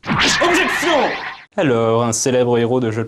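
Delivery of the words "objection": cri d'avocat de tribunal, beaucoup plus aigu; objection criée. Acted. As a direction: coupe la parole d'un grand cri théâtral. Narrator interrupts the sketch. (le narrateur interrompt le sketch en criant, très aigu)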